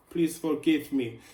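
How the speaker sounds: playful, badly faked Italian accent; mock Italian accent. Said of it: "accent. I don't even know which accent it is. Maybe Italian".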